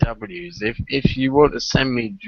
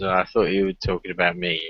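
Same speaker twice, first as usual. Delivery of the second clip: usual speaking voice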